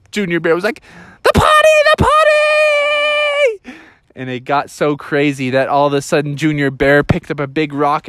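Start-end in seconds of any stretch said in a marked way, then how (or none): 1.2-3.8 loud, high, drawn-out squeal; freaking out. Acted. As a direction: squeal in desperate toilet panic. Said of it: "the kids were freaking out".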